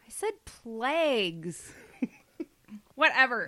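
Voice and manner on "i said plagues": "plagues" said slowly, with a clear s at the end; insisting on plagues. Shown as a demonstration. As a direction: slowly repeat "plagues" with the s ending. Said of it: "so you said plague".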